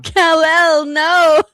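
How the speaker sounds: shouted movie line, hammed up; loud mock cry. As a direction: yell the name in mock despair.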